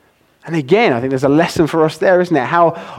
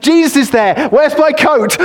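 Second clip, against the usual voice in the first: high, eager, tumbling out in excitement; excited; 10 semitones higher than usual. Retold or imitated. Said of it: "he's so excited".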